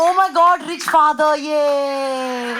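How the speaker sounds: loud, high squeal of glee; mock delight. Daughter squealing about rich father's ticket change, gleeful. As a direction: squeal with giddy joy at being spoiled. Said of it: "I was like".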